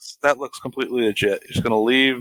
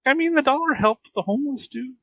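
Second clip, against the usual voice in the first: higher, sing-song retort; mock earnest high voice. Retorting about the homeless dude, high mock voice. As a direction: lift it up playfully. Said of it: "I'm like".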